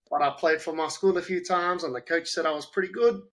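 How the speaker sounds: lightly voiced carefree kid; student's voice.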